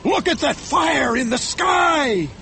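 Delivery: high, drawn-out captain's shout; slow, exalted high shout; crying out. A narrator's reading. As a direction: cry out over a wild storm. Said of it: "he cried".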